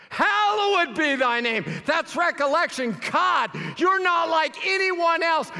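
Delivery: loud, high, impassioned sermon shouting; fervent preaching. Acted. Preacher proclaiming to the congregation, full-force shouting. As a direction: cry out God's greatness to the whole room.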